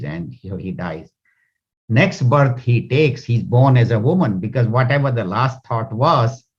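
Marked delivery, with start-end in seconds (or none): 0.0-1.1 much softer, fading away on dies; quiet solemn close. A narrator's reading. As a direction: let the words die out softly. Repeating the king's death, fading.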